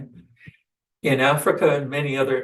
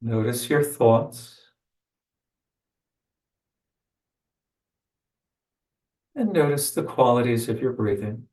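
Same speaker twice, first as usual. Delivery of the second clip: guided meditation. Acted. Leads a meditation.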